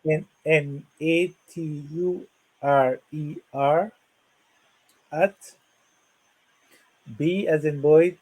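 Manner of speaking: slow, careful dictation of an email; over enunciating. Shown as a demonstration. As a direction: spell it out letter by letter, slowly. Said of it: "let me say that slowly".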